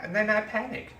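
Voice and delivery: normal talking voice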